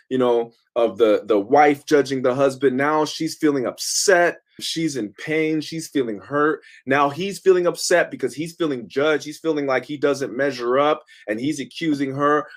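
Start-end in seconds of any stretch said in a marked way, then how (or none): none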